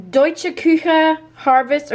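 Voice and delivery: crisply pronounced German, slow; German accent. Pretend. Vlogger naming a German soup brand, German accent. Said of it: "when I do the German, my accent has to go away".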